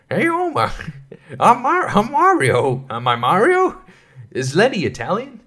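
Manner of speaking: Lenny-ish Mario voice. Retold or imitated. Mocking the SNL Mario as Lenny, high cartoon voice. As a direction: squeak it out like a goofy Mario. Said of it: "does sound like Lenny".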